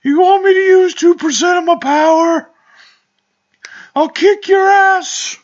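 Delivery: very high, loud squawking slacker threat; hammed-up cartoon outrage in falsetto; Shaggy. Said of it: "doing the Shaggy voice is fun".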